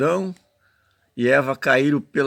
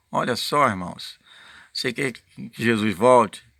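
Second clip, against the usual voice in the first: usual speaking voice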